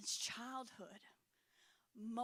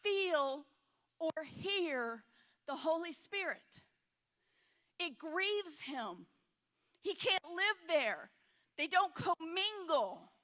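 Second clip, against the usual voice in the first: loud, slow, emphatic preaching; full-force preaching; 5 semitones higher, 9 dB louder, slower than usual. Acted. Pastor preaching to the church, loud and slow. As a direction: preach it hard to the congregation. Preacher driving a point home.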